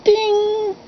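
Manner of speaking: high, ringing "ding" held on one pitch; imitated piano note. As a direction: mimic a struck piano key being tuned.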